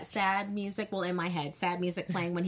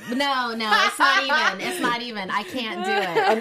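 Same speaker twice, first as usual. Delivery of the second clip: laughing it off; 4 semitones higher, 8 dB louder than usual. Real, not acted. Accent attempt breaks into laughter.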